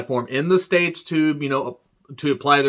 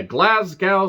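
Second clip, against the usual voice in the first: normal talking voice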